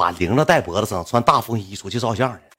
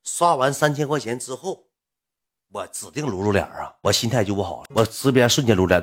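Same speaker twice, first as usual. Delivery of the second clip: normal talking voice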